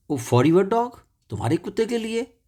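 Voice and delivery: high, rising open-mouthed astonishment; astonished, high-pitched query; surprised question. A narrator's reading. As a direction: ask it amazed, pitch climbing right up. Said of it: "In surprise, यानी कि आश्चर्यचक".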